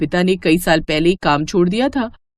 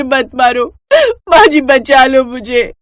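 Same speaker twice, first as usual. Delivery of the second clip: pleading cry; 6 semitones higher, 4 dB louder, slower than usual. Acted. Wife beaten, begs mother-in-law.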